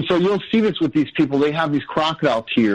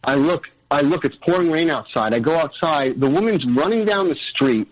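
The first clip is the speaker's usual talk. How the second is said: usual speaking voice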